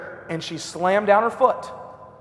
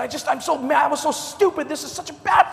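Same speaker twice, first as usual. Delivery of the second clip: higher, rising into a shout; upset rant; 8 semitones higher than usual. Retold or imitated. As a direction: let it build from upset to a yell. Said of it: "And she said".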